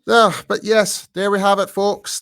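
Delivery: high, loud host sign-off, drawn out slow at the end; podcast sign-off. Acted. Host signing off the podcast to the folks listening, upbeat presenter voice. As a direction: wrap up the show like a presenter with a flourish.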